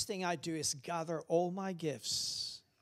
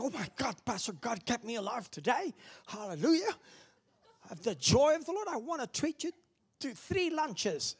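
higher, excited mock John; imitating grateful John; 6 semitones higher than usual. Pastor imitating John offering lunches, higher and excited. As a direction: play John gushing with joy.

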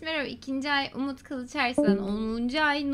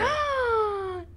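shocked gasp; 9 semitones higher than usual. Real, not acted. Shocked at teammate's jab.

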